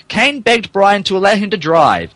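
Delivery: normal talking voice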